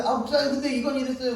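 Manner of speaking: put-on high-pitched child pleading their case; protesting child. Child explaining their side to pastor, high protest.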